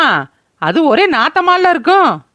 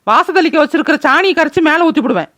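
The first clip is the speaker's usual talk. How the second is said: normal talking voice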